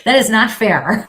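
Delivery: loud, laughing "not fair" at a friend; laughing protest. Real, not acted. To her co-host about losing the poll, laughing loudly.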